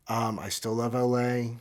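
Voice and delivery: ordinary delivery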